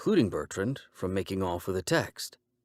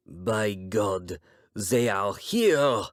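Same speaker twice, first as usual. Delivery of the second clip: awed exclamation swelling on HERE; Bertrand, marveling; much slower than usual. Acted. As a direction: draw out "here" in rising, accented amazement. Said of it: "Bertrand marveled".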